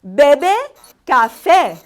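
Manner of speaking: Bebe loud and rising, café falling; deliberate teacher model; slow Spanish drill. Shown as a demonstration. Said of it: "so here we go".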